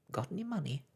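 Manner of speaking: high, sharp sprite question. Acted. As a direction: snap the question out. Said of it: "the star asked sharply".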